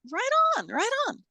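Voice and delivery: humoring someone with bright mock enthusiasm; mock cheerful agreement. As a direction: chirp it like a polite brush-off. Mock agreement with God-channelers.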